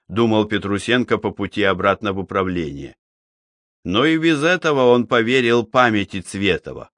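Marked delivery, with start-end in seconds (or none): none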